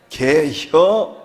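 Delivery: 충청도 사투리로 길게 늘여서; drawn-out Chungcheong 개혀. Shown as a demonstration. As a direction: draw out 개혀 with relish. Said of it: "충청도는 딱 두 마리로".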